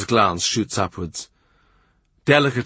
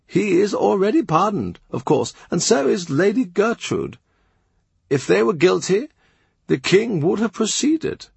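higher-pitched aged churchman, earnest and mild; old bishop; 10 semitones higher than usual. Acted. Old Bishop Fisher defending Barton to Cromwell, a higher earnest voice. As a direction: speak up for her miracles as a devout old bishop.

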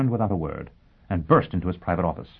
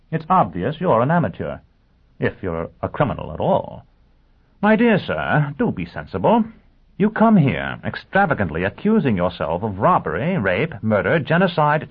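swooping, emphatic lecturing voice; dismissive lawyer, exasperated; 6 semitones higher, 5 dB louder than usual. Acted. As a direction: scold the client with theatrical disbelief. Lawyer rebukes would-be criminal.

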